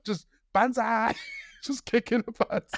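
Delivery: high, laughing retelling. Real, not acted. Telling the host how he lost it, laughing. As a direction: laugh through the memory. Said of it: "Lost it".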